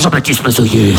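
very low announcer name drop; deep voice-over naming the star; trailer announcer. Acted. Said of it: "film de flic".

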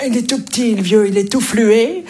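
put-on high, thin little-old-man voice; tiny old man. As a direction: squeak it like a frail old man. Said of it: "il y a un petit vieux qui arrive".

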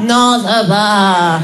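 Martine. Acted. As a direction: drag it out like a weary colleague. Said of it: "Elle est où ma collègue Martine?".

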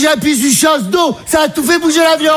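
mock mum yelling, high; mother explaining. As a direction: blurt it out like the panicked mum. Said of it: "Elle dit".

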